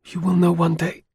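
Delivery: evasive. A narrator's reading. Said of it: "her evasive reply".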